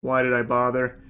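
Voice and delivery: low, soft voice of inner regret; imagined weary resignation. Retold or imitated. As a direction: say it as the waiter's deflated private thought. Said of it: "He probably just thought to himself".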